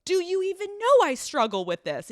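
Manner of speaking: high, loud, incredulous demand; outraged question. Retold or imitated. Girlfriend demanding her boyfriend get it, high and loud. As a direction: plead with him in outrage.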